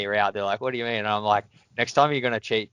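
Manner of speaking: usual speaking voice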